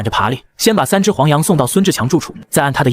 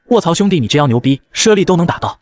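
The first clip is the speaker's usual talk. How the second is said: usual speaking voice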